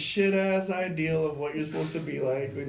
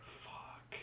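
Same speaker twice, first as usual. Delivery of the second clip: whispered curse; 21 dB quieter than usual. Retold or imitated. Seeing the feet around him.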